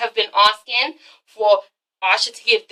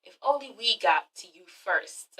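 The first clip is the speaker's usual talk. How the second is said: much softer, envious aside; jealous murmur; 3 semitones lower, 14 dB quieter than usual. Retold or imitated. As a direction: say it under your breath, like jealous teammates. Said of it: "they're sitting there thinking like".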